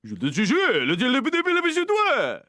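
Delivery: a hammed-up excited Frenchman; mock French gibberish. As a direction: squeal the fake French at him. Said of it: "he's like".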